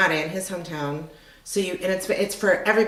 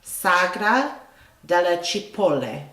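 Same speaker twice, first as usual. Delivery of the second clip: Sagra della Cipolle said slowly, phrase by phrase; slow, separated "Sagra" then "della Cipolle"; 3 dB louder, slower than usual. Shown as a demonstration. Repeating the Italian name for the listener, slowly. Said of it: "Did you say that slow?".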